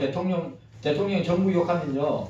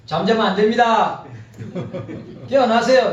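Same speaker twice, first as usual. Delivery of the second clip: calling out; 5 semitones higher, 6 dB louder than usual. Real, not acted. Preacher rousing dozing congregation.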